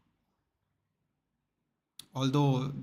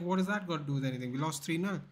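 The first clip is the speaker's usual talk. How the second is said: fast indignant retort; 3 semitones higher, faster than usual. Retold or imitated. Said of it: "He's like".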